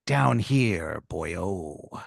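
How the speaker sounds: low growly pirate, soft at the end; lightly voiced pirate calling from below. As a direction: call up like a hidden pirate. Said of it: "the sound of, like, a pirate".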